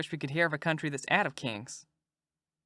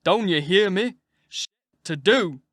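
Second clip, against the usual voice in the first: put-on louder and higher angry command to the child; mighty loud; 4 semitones higher, 7 dB louder than usual. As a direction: bark the order at her, fed up. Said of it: "mighty loud".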